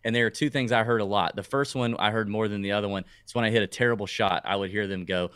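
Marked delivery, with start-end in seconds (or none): none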